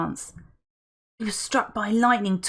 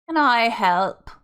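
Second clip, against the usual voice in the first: put-on slow, raspy, posh uptown accent; a butler's raspy posh accent, slowly; 4 semitones higher, 6 dB louder, slower than usual. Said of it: "his voice raspy, with the poshest uptown accent".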